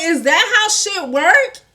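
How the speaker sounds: high, loud, slow challenge; indignant question. Retold or imitated. Retold outrage, challenges him.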